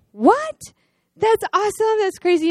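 high, thrilled cry of disbelief; excited disbelief. Retold or imitated. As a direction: squeal the good news back. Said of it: "I was like".

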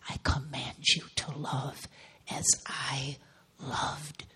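hushed, reverent whisper of God's command. Acted. Preacher voicing God's command to love, in a reverent whisper.

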